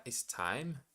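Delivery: normal talking voice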